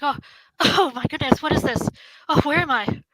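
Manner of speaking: confused. Acted. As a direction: look around bewildered, a laugh on the first word. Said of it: "as confused as you may be".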